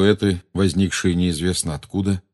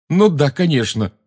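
derisive; 7 semitones higher, 4 dB louder than usual. A narrator's reading. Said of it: "ответить смехом".

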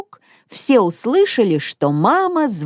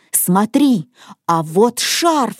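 ordinary delivery, nothing marked

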